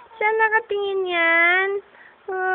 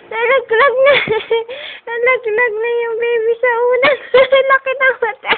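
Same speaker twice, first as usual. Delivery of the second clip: high, loud words breaking into giggles; real amused laughter; 5 semitones higher, 6 dB louder, faster than usual. Real, not acted. Parent amused that the baby slid off the pillow, laughing squeal. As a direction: squeal it through helpless laughter.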